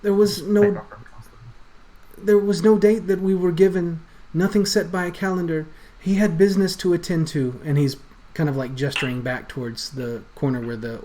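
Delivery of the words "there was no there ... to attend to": higher, faster, stammering in fear; panicked stammer. Acted. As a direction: blurt it out terrified. Rat NPC explains.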